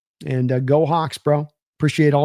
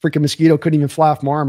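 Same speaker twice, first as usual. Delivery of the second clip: ordinary delivery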